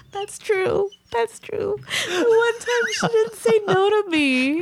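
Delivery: very high, laughing through the words; real laughter. Real, not acted. Speaker conceding to co-host's joke, cracking up. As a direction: talk helplessly through a laughing fit. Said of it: "I finally got her to laugh".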